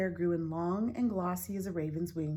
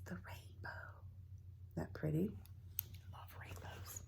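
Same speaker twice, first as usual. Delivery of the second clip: soft hushed aside; whisper; 2 semitones lower, 14 dB quieter than usual. Real, not acted. Reader sharing with children, a soft whisper. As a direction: whisper it fondly.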